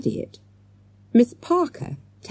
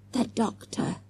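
much softer, breathy hush; breathed; 5 semitones higher, 8 dB quieter than usual. A narrator's reading. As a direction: barely breathe the words in awe. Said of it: "Morgan breathed".

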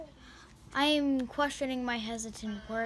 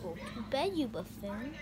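low-key order; 3 semitones lower, 6 dB quieter than usual. Pretend. Telling toy to sleep, first quietly.

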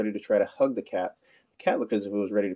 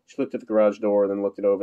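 plain reading voice

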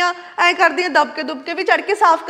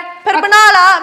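louder, dragged-out challenge; slow defiant retort; 2 semitones higher, 6 dB louder, much slower than usual. Acted. Snapping at her sister-in-law, slow and louder. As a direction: spit each word out slowly.

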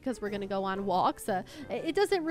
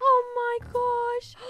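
high, slow, loud squeal; squeal of delight; 11 semitones higher, 6 dB louder, much slower than usual. Real, not acted. Gamer melting over a cute build piece, drawn-out high squeal. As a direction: stretch out the squeal of joy. Sees something she loves.

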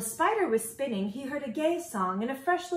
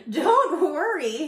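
high, loud, drawn-out reassurance; laughing bird; 6 semitones higher, 8 dB louder, slower than usual. A narrator's reading. Bird soothes the plucked moose, laughing voice. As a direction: brush it off with a merry lilt. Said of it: "he laughed".

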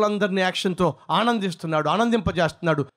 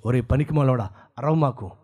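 lion voice; 8 semitones lower than usual. Acted.

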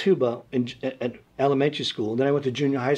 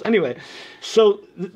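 ordinary talk, nothing marked

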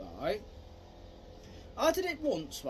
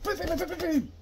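quick, higher boom noises; punch sound effects; 6 semitones higher, 4 dB louder than usual. Shown as a demonstration. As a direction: tap out a combo of hits.

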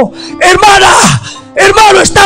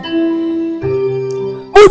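whispered lament; 4 semitones higher, 6 dB quieter than usual. Acted.

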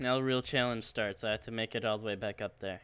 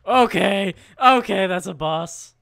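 alarmed; 6 semitones higher, 12 dB louder than usual. Real, not acted. Boss appears.

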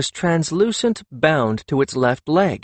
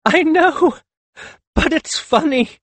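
high giggly voice, louder; giggling; 4 dB louder than usual. Acted. Akhet Ten giggling at the hungry cats, high and giggly. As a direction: giggle through the words. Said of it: "Akhet Ten giggled".

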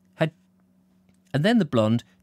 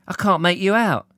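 lightly voiced blonde, higher and louder; blonde's voice; 8 semitones higher, 5 dB louder than usual. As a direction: play the blonde, pitched up and puzzled.

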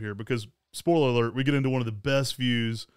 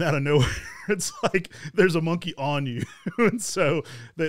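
higher, laughing as the surprise is told; 4 semitones higher than usual. Real, not acted. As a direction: break into laughter mid-story. Laughing at surprise monkey encounter.

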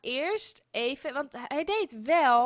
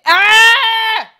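slow, drawn-out scream, higher and much louder; furious shout; 7 semitones higher, 20 dB louder than usual. Real, not acted. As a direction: wail at the game in full rage. Game fails again, screaming.

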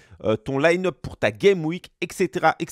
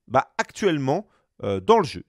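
ordinary talk, nothing marked

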